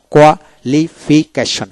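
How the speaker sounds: slow, split "qualification" into koa-li-fi-keshon; syllable by syllable. Shown as a demonstration. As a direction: break "qualification" apart for a learner. Teacher splits word into syllables.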